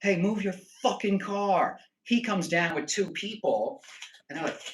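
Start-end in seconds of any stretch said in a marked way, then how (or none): 0.0-1.8 retold yelling. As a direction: re-enact yelling at a neighbour, held back. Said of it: "I start yelling, I'm like".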